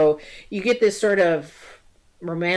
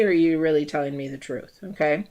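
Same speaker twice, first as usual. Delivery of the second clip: ordinary talk, nothing marked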